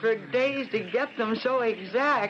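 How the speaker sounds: high, gushing thanks; thrilled thanks to Mrs Lanning; delighted gratitude. Acted.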